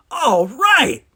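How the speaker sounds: loud, high burst of relief; relieved. A narrator's reading. Geordi gets his phase modulator working, exclaims with relief. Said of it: "said Geordi with relief".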